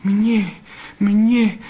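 overwhelmed vixen, no real whisper; overcome sobbing. A narrator's reading. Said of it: "zašeptala a zaštkala". (dojatě, vysokým hlasem)

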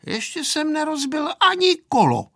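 put-on high-pitched boyish retort; mildly huffy child defending himself; dignified retort. A narrator's reading. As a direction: answer back with wounded dignity. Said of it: "odpověděl důstojně Pepíček".

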